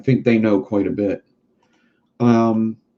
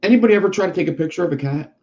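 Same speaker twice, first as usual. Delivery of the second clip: higher and quicker, like a first try; raised, fast take; 6 semitones higher, much faster than usual. Shown as a demonstration. Asking the audience, a quick raised question. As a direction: throw the question out fast and high. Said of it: "repeated line".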